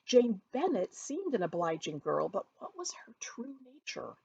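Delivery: plain reading voice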